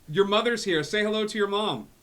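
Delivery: high, booming dad on a bad line; loud excited dad. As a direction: shout into the stone as an eager dad. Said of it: "it's Dad".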